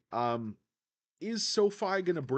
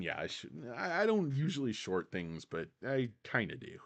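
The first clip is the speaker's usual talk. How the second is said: ordinary talk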